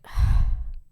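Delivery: long unvoiced sigh of giving in; weary sigh. Odysseus sighs before giving in to his crew. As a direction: exhale like a tired captain.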